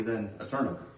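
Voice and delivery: usual speaking voice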